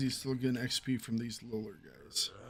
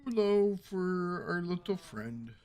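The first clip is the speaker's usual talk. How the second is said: slow, higher-pitched mock lament; playful drawl; 5 semitones higher, slower than usual. Playful drawn-out echo about game companion.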